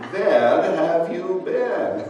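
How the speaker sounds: slow, laughing imitation of a heavy German accent; a German innkeeper's heavy accent, lightly mocked through laughter. Innkeeper greeting the late hiker, in a laughing German accent. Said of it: "in this very heavy German accent".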